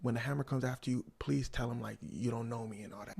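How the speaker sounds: ordinary talk, nothing marked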